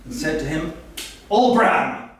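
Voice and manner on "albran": raised, louder mock-angry address; rather angry. Churchill greeting father back in London, rather angry. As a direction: call the name out higher, in comic anger. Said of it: "sounded rather angry".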